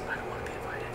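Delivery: barely audible whisper; hushed mock confession; whispered aside. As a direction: drop to a whisper for a confided thought. Retells his private realization.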